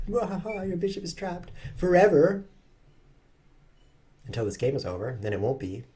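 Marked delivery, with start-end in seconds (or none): 0.1-2.4 higher, slow taunting gloat; gloating opponent. Pretend. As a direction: voice the opponent mocking your trapped bishop. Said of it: "Or will he just laugh?".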